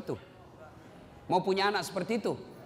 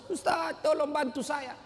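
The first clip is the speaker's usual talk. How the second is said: high, drawn-out pleading wail; mildly mock-weeping appeal; imitated crying; 8 semitones higher, slower than usual. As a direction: act a tearful woman calling for help.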